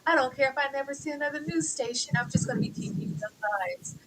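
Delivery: soft, high, put-on voice of fake contentment; quiet, prissy mock of a content teacher; mocking her voice. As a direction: mimic her lying sweetly and softly. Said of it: "saying, like".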